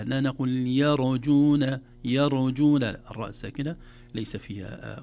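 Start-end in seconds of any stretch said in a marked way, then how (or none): 0.7-2.8 يرجون as "ya-ru-joona", louder and slow; bounced R mistake. Shown as a demonstration. Teacher showing what to avoid, R bounced. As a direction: add a bounce after the R in يرجون. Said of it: "دون قلقلة".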